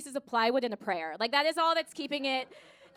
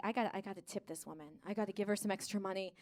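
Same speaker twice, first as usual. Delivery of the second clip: low rushed mutter; 6 semitones lower, 6 dB quieter than usual. Retold or imitated. Quiet, low fast inner thought.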